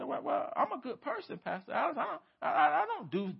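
a hint of a hammed-up self-righteous member; mock self-justifying churchgoer. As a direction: play a smug good person defending himself. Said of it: "We say".

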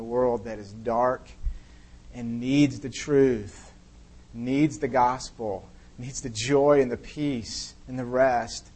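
slow, drawn-out phrases; slow prayer. Real, not acted. Pastor praying for a dark world, slow and drawn out. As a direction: let each "needs" land slowly. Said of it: "slowed prayer phrases".

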